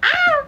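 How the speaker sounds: short high-pitched squeal; sharp, very high "ah"; high yelp. Real, not acted. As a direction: yelp in sudden surprise. Reacts to a viewer's comment.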